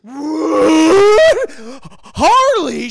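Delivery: shocked what. Retold or imitated. Bryce hearing the name Harley, shocked. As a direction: jolt at the name. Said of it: "And he's like".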